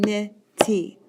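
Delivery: "nitty" stretched into two separate beats; word split into syllables. Shown as a demonstration. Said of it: "that of course is two syllables".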